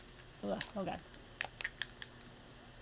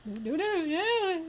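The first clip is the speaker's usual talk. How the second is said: high, loud string of no's; rising panic mid-game; alarmed pleading; 9 semitones higher, 7 dB louder, slower than usual. Real, not acted. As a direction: cry out as if being chased. Being chased in game.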